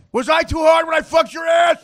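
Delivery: high shouted mock question; imitated furious yell. Host imitating Uncle Bob yelling at his dog, high shout. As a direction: yell it as an angry owner. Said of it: "Uncle Bob's like".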